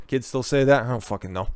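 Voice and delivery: normal talking voice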